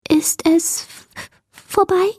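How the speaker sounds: high, timid stammer; high-pitched fearful question, stuck on the first sound; trembling voice. A narrator's reading. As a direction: ask haltingly in a tiny frightened creature voice. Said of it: "fragte eine zitternde Stimme".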